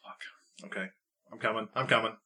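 hushed, deflated employee; sheepish muttering. Acted. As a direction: trail off softly while giving in to the boss. Scolded worker called back from break.

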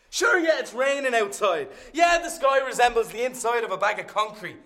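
loud, high comic rant; shouting. Acted. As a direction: bellow it at the crowd. Ranting at the audience about rain.

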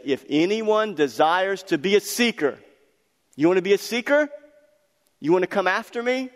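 high, loud call to the crowd; loud preacher challenge. Acted. Preacher daring the congregation to follow, high and loud. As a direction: throw the question at them.

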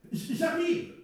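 stammered, high-pitched shouted reply; calling back. Acted. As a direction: call back loudly, tripping on the first sound. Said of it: "a-t-il en répondu une fois encore".

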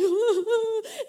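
high, wailing fake boo-hoo; hammed-up sob; mock crying. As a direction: imitate bursting into tears, loudly. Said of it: "I bursted out crying".

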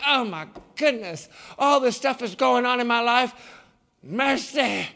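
overwhelmed sigh ending in "Mercy"; playfully fed up, at the end of your rope; a hammed-up weary Southern lament; mock exasperation. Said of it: "You're like".

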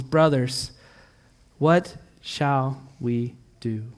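low, soft and slow, lost and pleading; slow quiet despair; hushed plea. Acted. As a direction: ask it like a helpless crowd. Crowd's despairing question in scripture.

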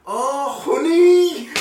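high, loud "Oh, honey!" as it dawns; sudden realisation shout. Retold or imitated. Teller voices all three staff finally understanding, loud high shout. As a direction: cry out in sudden recognition. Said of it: "And all three of them went".

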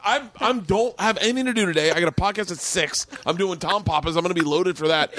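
high pleading complaint; plead, exasperated; pleading whine. Retold or imitated. Pleading his case.

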